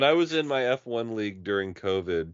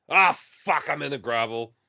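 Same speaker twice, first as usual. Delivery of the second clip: Aussie crash groan; 4 semitones higher than usual. Retold or imitated. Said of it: "he's like".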